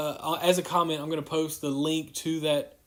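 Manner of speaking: ordinary delivery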